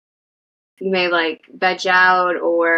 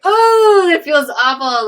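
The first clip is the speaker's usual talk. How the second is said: high, loud pained oh, near tears; retold dismay; 9 semitones higher, 5 dB louder, slower than usual. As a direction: act out her own flustered dismay. Retelling her reaction to husband.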